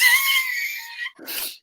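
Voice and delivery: squealing burst of laughter; high shrieking laugh. Real, not acted. Big laugh at own joke.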